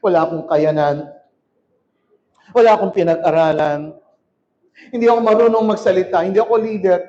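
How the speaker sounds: low, limp voice of a defeated believer; defeated self-talk. Retold or imitated. Pastor mimics a believer putting himself down, low and defeated. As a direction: mope it out like someone who has given up. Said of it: "Pero ikaw, lagi kang".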